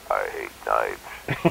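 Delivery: low, very slow Stallone drawl; Stallone impression. Stuntman retelling the fight, doing Stallone low and slow. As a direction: drop way down and stretch each word like a tough action hero. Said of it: "That's my best Stallone impression".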